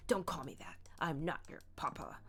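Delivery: lightly voiced stern old dad; stern papa. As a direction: correct her sternly. Papa rebukes Sabine.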